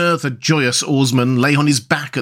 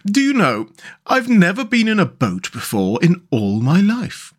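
higher character voice, full of wonder; wondering Mole; 6 semitones higher than usual. Acted. Mole telling Rat of his first boat ride, wondering. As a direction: confide it as a naive novelty.

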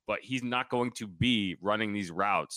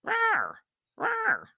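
very high, loud, wordless yelling rant; Mad Dog; 5 dB louder, slower than usual. Retold or imitated. Host mocking a sarcastic ranter, high-pitched yelling impression. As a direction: squawk nonsense at the mic in a mock rage. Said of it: "I'll talk like the Mad Dog".

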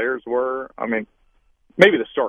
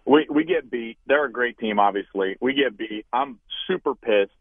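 usual speaking voice

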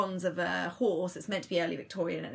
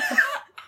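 howling at the moon; 5 dB louder than usual. Pretend. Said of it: "So Em's howling at the moon".

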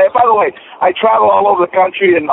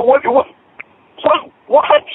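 stunned disbelief; 8 semitones higher, slower than usual. Retold or imitated. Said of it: "I said".

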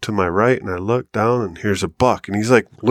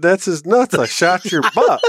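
higher, cracking up mid-quote; laughing punchline; 9 semitones higher than usual. Real, not acted. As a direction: deliver the punchline while losing it to laughter. Punchline of the prank story.

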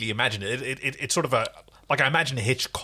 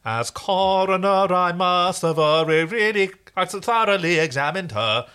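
Munchkin coroner; 6 semitones higher, 4 dB louder than usual. Acted. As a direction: announce it like a pompous official. Quoting Wizard of Oz coroner.

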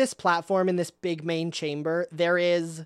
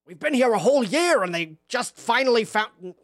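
exasperated Grinch. Acted. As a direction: open in an agitated outburst, then settle into complaint. GM voices a complaining Grinch.

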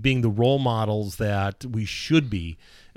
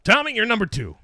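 high, loud proclamation; triumphant announcement; 12 semitones higher, 5 dB louder than usual. Retold or imitated. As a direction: imitate a thrilled boss breaking the news. Boss repeats great ratings news.

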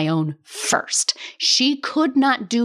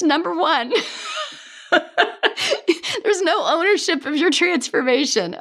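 laughing; 7 semitones higher than usual. Real, not acted. Laughs at losing her thread.

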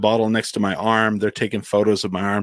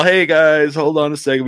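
calling out from stage; 5 semitones higher, 5 dB louder than usual. Retold or imitated.

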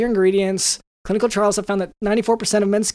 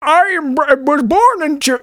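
raised, loud, drawn-out villain impression; Sean Connery turned Bane; 6 semitones higher, 5 dB louder, slower than usual. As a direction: build from a choppy start to a loud, high declaration. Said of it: "at what point does Sean Connery become Bane".

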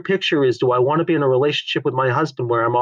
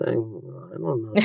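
slow, much softer "I don't know"; drawn-out, lazy "I don't know"; shrugging "I don't know"; 3 semitones lower, 10 dB quieter, much slower than usual. Shown as a demonstration. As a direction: let it drift out, not caring. Modeling refusing to decide.

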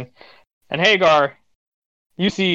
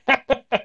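short acted chuckle, higher-pitched; guard's chuckle; 8 semitones higher than usual. Guard reacts to a traveler's joke with a short chuckle. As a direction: chuckle briefly in character. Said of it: "The guy kinda chuckled".

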